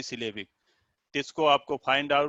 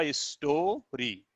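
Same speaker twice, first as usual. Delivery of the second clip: Story with a drawn-out store, then री; split into syllables; 5 dB quieter, much slower than usual. Shown as a demonstration. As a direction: break story in two at store. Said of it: "दो बार स्वर का उच्चारण". (Story को स्टो-री में खींचकर बोलना)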